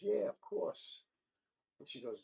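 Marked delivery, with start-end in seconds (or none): 0.0-1.0 drawn-out reassuring doctor. Acted. Doctor reassuring, slow high.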